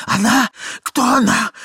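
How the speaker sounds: high, loud startled questions; high-pitched, frantic exclamation; alarmed outcry. Acted. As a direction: blurt it out in alarm. Ivan cries out in alarm.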